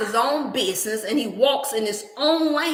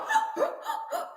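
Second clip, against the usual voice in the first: high shrieking monkey laugh, much higher and softer; monkey imitation; 6 dB quieter than usual. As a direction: mimic a monkey's hooting laugh. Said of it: "every time a monkey go".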